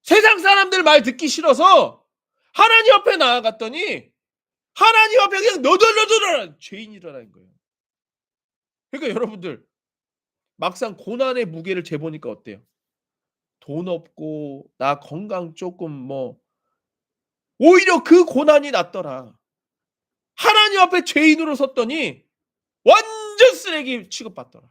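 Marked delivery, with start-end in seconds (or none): 0.0-7.5 high, loud cry of conviction; impassioned preaching. Real, not acted. Preaching climax. 17.6-24.7 high, loud cry of conviction; impassioned preaching. Real, not acted. Preaching climax.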